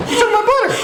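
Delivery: high, loud squealing goblin; outraged goblin. Acted. Goblin outraged about butter.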